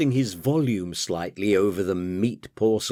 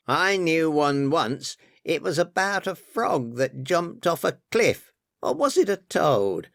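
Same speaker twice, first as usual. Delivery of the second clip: higher-pitched, absent drone; droned; 7 semitones higher than usual. A narrator's reading. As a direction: drone on, staring into space. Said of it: "Larry droned, staring into space".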